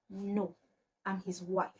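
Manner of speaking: soft, cold, understated reveal; imitated cold wife. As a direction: deliver it quietly, as if with a cold stare. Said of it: "with the coldest stare ever".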